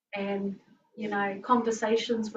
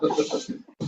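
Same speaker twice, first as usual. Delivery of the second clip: airy laugh; breathy laugh. Real, not acted. Laughing at the story.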